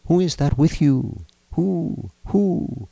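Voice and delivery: high seance voice calling spirits; calling medium. A narrator's reading. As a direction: draw out each "who" in a high, eerie call. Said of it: "she called".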